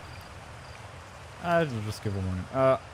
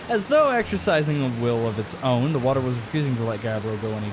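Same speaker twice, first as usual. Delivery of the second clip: spooky storytelling; 5 dB louder than usual. Acted. Storyteller spooking the listener with a campfire tale. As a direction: build the tension, lean on the mystery.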